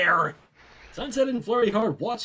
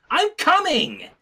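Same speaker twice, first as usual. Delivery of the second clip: calling out; 7 semitones higher, 3 dB louder, slower than usual. Acted. Character calling from other side.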